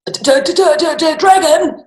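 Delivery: high, loud terrified stutter; father hearing about the dragon, stammering in fear. A narrator's reading. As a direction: stutter in shock. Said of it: "said the father".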